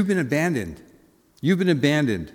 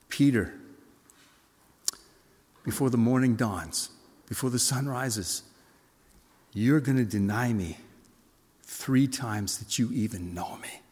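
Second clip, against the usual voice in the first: low, soft, slow and sorrowful; heart breaking; 4 semitones lower, 5 dB quieter than usual. Acted. Jesus sorrowfully foretelling Peter's denial, heart breaking. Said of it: "his heart was breaking as he said it".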